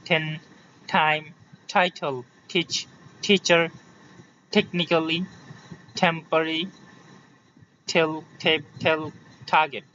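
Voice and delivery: word list, "টাইম" to "টার্গেট", each t with a puff; separated model words, airy t as in "টেপ"; aspirated T. Shown as a demonstration. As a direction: drill "টিচার" and "টেল" one by one, t aspirated. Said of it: "আমরা কয়েকটা ঠতে যাই".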